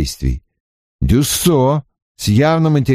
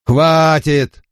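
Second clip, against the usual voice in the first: grandfatherly stop; 6 semitones higher than usual. A narrator's reading. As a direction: halt him like a grandfather. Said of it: "как дедушка, успокаивающий ребёнка".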